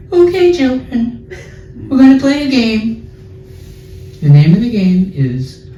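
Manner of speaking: high, singsong kindergarten teacher. Retold or imitated. Teacher announcing a game to children, high put-on teacher voice. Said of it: "the teacher has this teacher voice".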